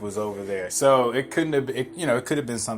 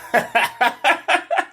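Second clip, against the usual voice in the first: high, giggling laugh; high nervous giggle over the awkward moment; 14 semitones higher, 5 dB louder than usual. Real, not acted.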